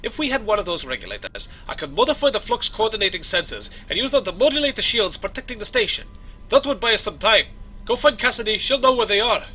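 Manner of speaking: hammed-up Scottish brogue; Scottish accent. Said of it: "my Scottish accent needs some work".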